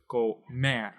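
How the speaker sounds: slow "comer" with weight on "mer"; teacher's careful "comer", second syllable drawn out; stressed last syllable. Shown as a demonstration. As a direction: lean on the final "mer" of "comer". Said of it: "going to emphasize this last".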